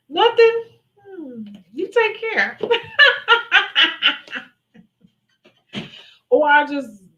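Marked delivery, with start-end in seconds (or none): 0.1-2.6 petty. Pretend. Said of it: "look them up and down". 2.6-4.4 high, loud cackle; high amused cackle; petty laugh. Real, not acted. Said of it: "laugh when I see it".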